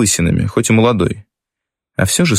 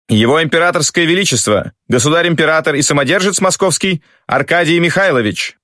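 formal herald announcement; 7 semitones higher than usual. A narrator's reading. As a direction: announce the emperor to the hall.

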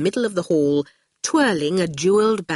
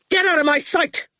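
high, sharp yell; 11 semitones higher than usual. A narrator's reading. Archer yells at his visitors to get out, high furious yell. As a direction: fling him out with a yell. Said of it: "he yelled".

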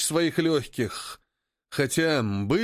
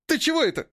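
very high-pitched startled question; jolted, indignant yelp of a question; startled protest; 14 semitones higher, faster than usual. Acted. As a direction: blurt it out, pitched way up. Jolted companion protests after bumping his head.

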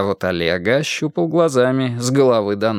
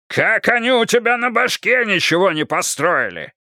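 furious yell; 10 semitones higher than usual. Acted. Karpov yells at mayor.

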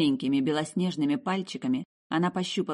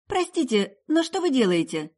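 put-on high, polite girlish question; young woman asking; 9 semitones higher, 4 dB louder than usual. A narrator's reading. Meryl asking the witch, high and polite. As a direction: ask the old woman gently, voice up high. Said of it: "поинтересовалась у старухи".

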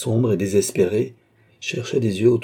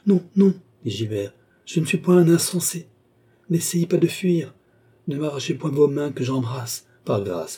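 higher, urgent plea; pitched-up desperate entreaty; desperate pleading; 7 semitones higher than usual. Acted. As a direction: plead urgently, trying to keep someone from leaving. Desperate pleading, trying to hold her.

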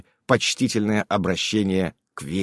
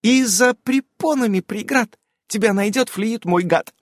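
put-on slow, higher declaiming child; childish mock-solemn verse; proud recitation; 12 semitones higher than usual. A narrator's reading. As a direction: recite it proudly, hands on hips. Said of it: "продекламировала".

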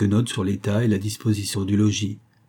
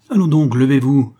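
loud, higher-pitched order; shouting; 5 semitones higher, 8 dB louder than usual. A narrator's reading. Husband orders the man out of bed, shouting. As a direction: shout an impatient command. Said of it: "en criant".

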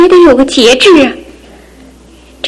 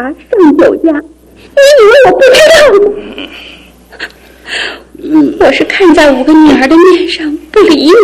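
tearful, rising anger; crying accusation; 3 semitones higher than usual. Acted. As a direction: sob through the accusation. Wife breaks down accusing husband.

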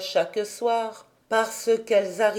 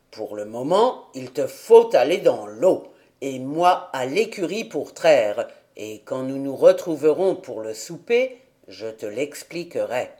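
put-on lower, gruff old man; old grandfather; 7 semitones lower than usual. A narrator's reading. Grandfather answers Heidi about the flowers, lower old man's voice.

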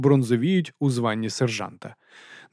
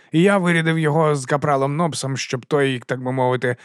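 ordinary delivery, nothing marked

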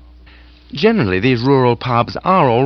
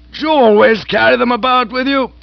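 higher, louder, gushing aged aunt; hearty aunt voice; 14 semitones higher, 5 dB louder than usual. Acted. Aunt gushing to Bertie about lunch. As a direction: raise the pitch and relish the food.

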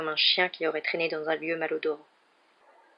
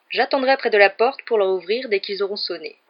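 put-on louder, lilting child's recital; keen pupil voice; eager boy reciting; 3 semitones higher, 6 dB louder than usual. A narrator's reading. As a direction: show off your rehearsed part. Boy eagerly recites rehearsed part.

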